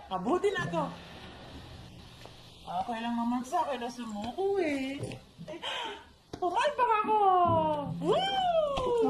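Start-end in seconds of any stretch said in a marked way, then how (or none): 6.4-9.1 put-on loud, high drunk whoop; drunk outburst. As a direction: whoop it out, wasted.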